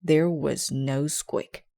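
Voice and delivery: usual speaking voice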